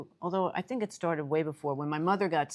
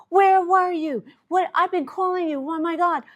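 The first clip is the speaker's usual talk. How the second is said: much higher, louder tearful panic; frantic crying sister; 12 semitones higher, 8 dB louder than usual. Retold or imitated. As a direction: imitate a sister calling in tears, frantic. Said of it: "And she's crying".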